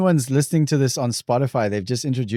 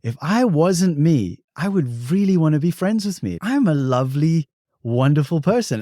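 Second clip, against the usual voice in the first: higher, gentle self-praise; kind self-talk; 5 semitones higher than usual. Retold or imitated. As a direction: speak kindly to yourself. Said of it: "I almost became the cheerleader".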